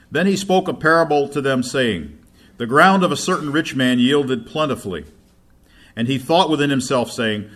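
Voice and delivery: loud, declaiming Bible reading; scripture reading. Acted. Preacher reading Luke to congregation, declaiming loudly. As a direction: proclaim the passage to the church. Reads scripture at full force.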